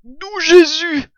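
high, drawn-out startled cry; exclaiming badger. A narrator's reading. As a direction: cry out loud and slow in astonishment. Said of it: "s'écria Blaireau". (exclamation forte et aiguë)

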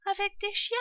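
put-on very high child's questioning voice; frightened fox cub. A narrator's reading. Fox cub asks his mother about danger, high and frightened. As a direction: ask like a scared little one. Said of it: "écarquillés de terreur".

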